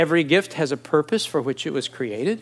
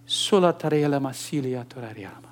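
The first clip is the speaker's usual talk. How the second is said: calm tongues demo; 3 semitones lower, 4 dB quieter than usual. Shown as a demonstration. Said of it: "W-, would you notice?".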